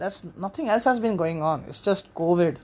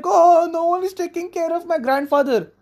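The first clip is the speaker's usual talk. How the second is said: mock wail; 9 semitones higher than usual. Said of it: "And she's like".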